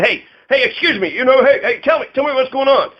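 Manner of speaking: high, pressing mock reporter; pushy reporter. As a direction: badger him with questions. Acting out pushy reporter demanding.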